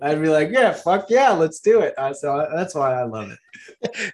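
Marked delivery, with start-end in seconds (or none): 0.5-1.9 gung-ho, raised-pitch yes; eager agreement. Acted. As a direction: jump in like you're totally sold. Imagines eagerly agreeing to idea.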